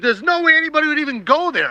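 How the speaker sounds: very high, rapid objection; incredulous protest. Retold or imitated. Diver insisting nobody would go into that hole, incredulous. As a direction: rattle it out high in sheer disbelief. Recounting his heated disbelief.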